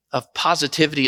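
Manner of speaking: usual speaking voice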